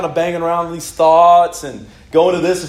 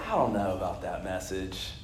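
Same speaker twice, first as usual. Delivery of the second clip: much lower, much softer voice of the doubting devil; devil's whisper of doubt; 10 semitones lower, 14 dB quieter than usual. Retold or imitated. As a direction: murmur it low like the devil sowing doubt. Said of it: "this is just like the devil".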